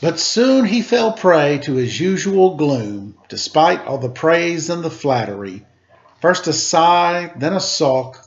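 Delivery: put-on lively read-aloud to children; storybook reading voice; story narration. A narrator's reading. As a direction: read it like a picture book, rhythmic. Reads picture book aloud.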